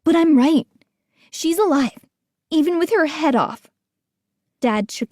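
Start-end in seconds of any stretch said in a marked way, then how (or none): 0.0-3.7 insistent. A narrator's reading. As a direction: insist you're right. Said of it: "Gates said".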